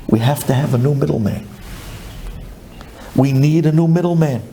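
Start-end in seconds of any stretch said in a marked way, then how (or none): none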